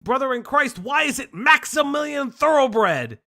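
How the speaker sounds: outraged shout at a silly name; exasperated shout. Real, not acted. Outraged at absurd character name.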